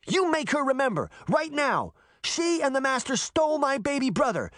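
high, fast furious demand; girl raging at an old man. A narrator's reading. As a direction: force him to act, right now. Furious girl confronts Canis.

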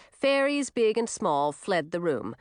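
usual speaking voice

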